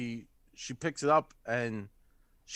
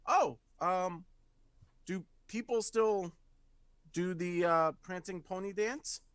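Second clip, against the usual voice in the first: higher, halting, tentative question; hesitant character voice; 5 semitones higher than usual. Acted. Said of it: "looks at Monty and is like".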